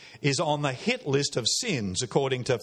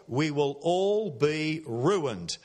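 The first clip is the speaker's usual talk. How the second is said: slow and measured, full uncontracted words; slow proper English; much slower than usual. Shown as a demonstration. Preacher explaining a slang phrase, slow proper English. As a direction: spell out "We will all be ruined" word by word. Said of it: "I'll say it nice and slowly in proper English".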